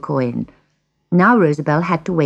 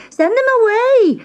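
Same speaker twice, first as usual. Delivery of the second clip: very high-pitched panicked cry; scream; 4 dB louder than usual. A narrator's reading. Girl sees the witch's cat, high-pitched scream. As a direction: shriek it in alarm. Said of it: "she screamed".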